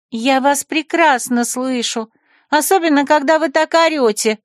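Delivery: high, sassy retort; snapping back. Acted. Secretary snaps back at the rector, high and sassy. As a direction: snap back with attitude. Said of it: "огрызнулась она".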